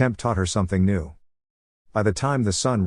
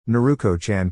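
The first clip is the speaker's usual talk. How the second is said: ordinary delivery, nothing marked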